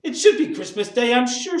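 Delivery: high, loud exasperation; indignant retort. A narrator's reading. Wife answers Bob's plea, indignant retort. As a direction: snap back, pitched right up. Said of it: "she said".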